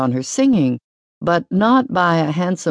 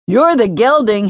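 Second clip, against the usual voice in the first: put-on high, louder boy's taunt; a kid pulling a face while teasing; little brother mocking the young chorister; Hamnet taunting; 8 semitones higher, 5 dB louder than usual. A narrator's reading. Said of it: "Hamnet responded, making a face".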